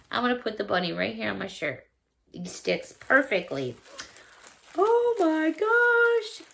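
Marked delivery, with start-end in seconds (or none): 4.8-6.5 high, slow, stretched-out amazement; amazed. Real, not acted. Viewer unwraps gift earrings, amazed and slow. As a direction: marvel at the surprise.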